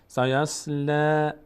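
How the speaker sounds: slow, drawn-out tajwid recitation; Quran recitation. Shown as a demonstration. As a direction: chant each word slowly. Recites verse.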